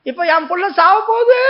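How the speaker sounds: high, loud wail of despair; wailing cry. Acted. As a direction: cry out that her child is dying. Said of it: "அப்படின்னு அழுதா". (உயர்ந்த உரத்த அழுகைக் குரல்)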